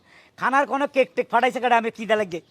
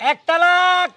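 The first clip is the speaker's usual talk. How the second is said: high, loud furious pronouncement; angry divorce declaration; 8 semitones higher, 4 dB louder, much slower than usual. Acted. Husband angrily divorcing his wife over the letter, furious. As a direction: spit out the divorce in fury.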